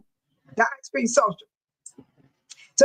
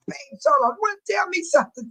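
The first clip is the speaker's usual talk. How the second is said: mock nagging caller; 7 semitones higher than usual. Mimicking someone tapping her.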